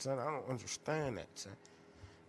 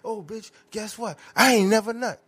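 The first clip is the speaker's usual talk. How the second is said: put-on loud, high taunting girlfriend; hammed-up girlfriend insult; mocking angry girlfriend; 7 semitones higher, 13 dB louder than usual. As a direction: throw the insult like a mad ex. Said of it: "my favorite one".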